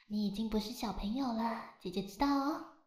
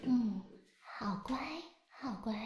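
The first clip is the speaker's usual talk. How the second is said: whispering. Acted. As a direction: breathe the praise gently. Praising child during bath.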